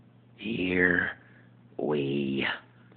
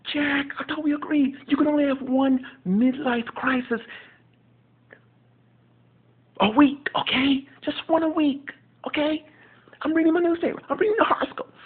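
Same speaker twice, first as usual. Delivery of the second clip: much higher, louder exasperated sitcom voice; Will impression; 4 dB louder than usual. Will scolding Jack over his newspaper, high and loud. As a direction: scold a friend who interrupts your paper. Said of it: "my impersonation of Will".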